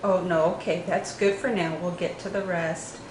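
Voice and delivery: ordinary delivery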